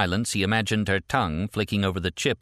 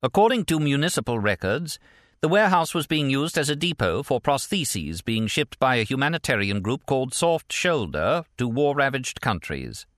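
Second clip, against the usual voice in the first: higher, projected newsreader delivery; formal bulletin voice, pitched up; news anchor read; 7 semitones higher than usual. A narrator's reading. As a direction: report it like a TV anchor. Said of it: "the male anchor said".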